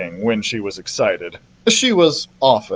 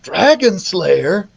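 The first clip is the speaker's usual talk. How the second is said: slow, raised-pitch startled query; sputtered question; 5 semitones higher, slower than usual. A narrator's reading. Jack reacts to the queen's words, sputtering. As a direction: question it slowly in surprise. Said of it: "Jack sputtered".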